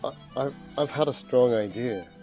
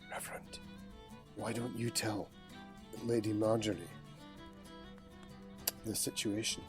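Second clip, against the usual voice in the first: hushed in-character scheming; whispering; 9 dB quieter than usual. Acted.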